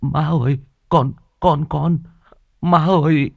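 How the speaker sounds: father tongue-tied with disbelief; stammering. Acted. Father stammering to his mother on seeing daughter walk. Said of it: "Ông lắp bắp".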